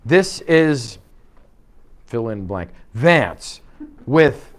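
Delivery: loud, halting script read, pauses between words; stilted script reading. Shown as a demonstration. Trainer shows agents a bad script read, stilted and halting. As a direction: read it off the page word by word, loudly. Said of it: "if you read a script like".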